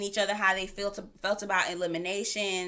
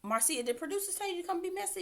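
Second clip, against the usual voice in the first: incredulous; 10 semitones higher, 6 dB quieter, faster than usual. Retold or imitated. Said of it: "I was like".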